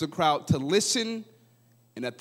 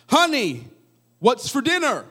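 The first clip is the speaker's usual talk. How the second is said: called out high and loud across the house; calling out; 8 semitones higher, 4 dB louder than usual. Retold or imitated. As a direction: call to the kitchen, louder. Husband calls, ten feet away.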